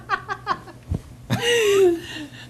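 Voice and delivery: long high-pitched giggle. Real, not acted. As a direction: burst into high laughter. Laughing at the joke.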